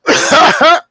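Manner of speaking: big burst of laughter; loud cackling laugh. Real, not acted. Loud laugh after joke.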